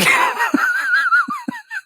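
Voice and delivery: real high-pitched cackling laugh; big laugh. Real, not acted. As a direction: crack up helplessly. Cracks up at nickname told.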